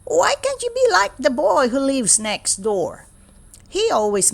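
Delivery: high, exasperated parent voice; nagging parent. Acted. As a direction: compare the child with the neighbour. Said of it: "my parents say".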